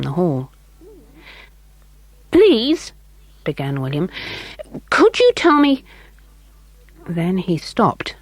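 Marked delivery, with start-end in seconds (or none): 2.3-2.9 put-on higher, eager boy asking politely; a small boy's polite voice. A narrator's reading. William voiced by narrator. 4.9-5.8 put-on higher, eager boy asking politely; a small boy's polite voice. A narrator's reading. William voiced by narrator.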